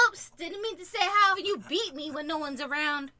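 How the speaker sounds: hammed-up outtake line; staged blooper. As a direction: play a phony accidental confession. Making up fake bloopers.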